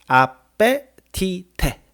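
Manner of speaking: 아페티테 drawn out syllable by syllable; spelling pronunciation. Shown as a demonstration. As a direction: say 아페티테 slowly, sounding every written vowel. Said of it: "철자는".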